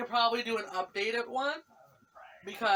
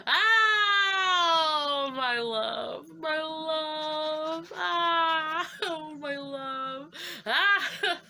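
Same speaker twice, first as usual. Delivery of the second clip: higher, sighing over him; swooning; 6 semitones higher, much slower than usual. Real, not acted. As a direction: melt with fangirl delight.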